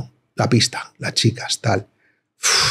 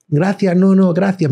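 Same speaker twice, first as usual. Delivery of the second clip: high forced politeness; 7 semitones higher than usual. Retold or imitated. Teen declining help again.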